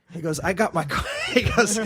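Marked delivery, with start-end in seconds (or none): none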